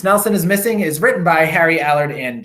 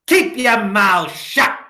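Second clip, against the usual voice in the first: strict witchy teacher; 7 semitones higher, 4 dB louder, slower than usual. A narrator's reading. Miss Swamp orders class.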